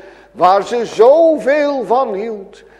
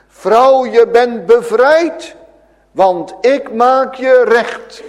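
louder, higher, ringing declaration; Jesus tells the bent woman she is freed; proclaiming Jesus; 4 semitones higher, 6 dB louder than usual. Acted. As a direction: announce her freedom with authority. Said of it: "wat zegt de Heer Jezus?".

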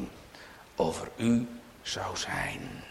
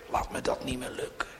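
repeated whisper; 6 dB quieter, faster than usual. Real, not acted. Prayer repeated, softer.

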